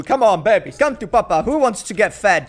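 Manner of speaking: loud, playful call to the babies; lightly hammed-up papa voice; doting papa calling. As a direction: call them over to be fed. Plays papa calling customers to feed.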